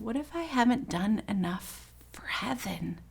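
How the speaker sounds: breathy tearful whisper, barely voiced. Dying mother asking her daughter about heaven, whispered through tears. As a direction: whisper it through tears, afraid of falling short. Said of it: "she started to cry".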